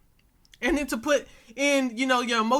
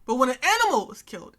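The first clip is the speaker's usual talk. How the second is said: ordinary talk, nothing marked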